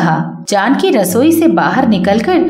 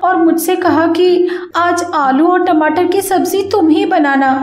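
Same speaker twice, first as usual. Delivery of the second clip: Kavita pleading; 6 semitones higher than usual. Acted. Kavita begs her sister-in-law's forgiveness with a higher whimpering plea. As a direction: fake a tearful apology.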